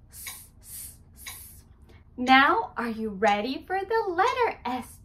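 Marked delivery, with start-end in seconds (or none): 0.1-1.3 hissed "S, S, S" unvoiced like a snake; s sound. Shown as a demonstration. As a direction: stretch each "S" into a hiss. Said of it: "Sound of the letter".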